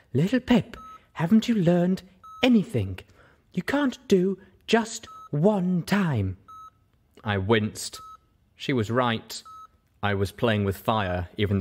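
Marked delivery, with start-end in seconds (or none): 0.1-6.4 high, slowing exasperated lecture; Velvet, exasperated. Acted. Velvet Remedy lectures Little Pip about addiction, exasperated. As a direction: drive the lesson home.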